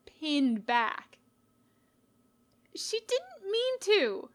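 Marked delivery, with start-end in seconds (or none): 2.7-4.3 high, slow pleading; tearful protest. A narrator's reading. Little sister pleads with Sans for her sister, tearful. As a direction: plead through tears, higher. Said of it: "the kit protests".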